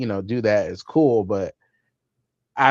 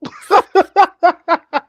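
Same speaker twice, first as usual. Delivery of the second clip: laughing mock wow; 9 dB louder than usual. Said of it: "I'm like".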